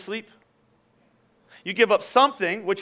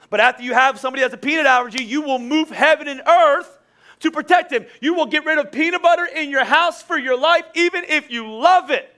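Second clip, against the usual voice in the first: higher, driving preacher delivery; impassioned preaching; 7 semitones higher, 5 dB louder than usual. Real, not acted. Preaches at full force.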